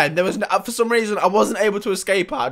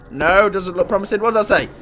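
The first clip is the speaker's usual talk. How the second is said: ordinary talk, nothing marked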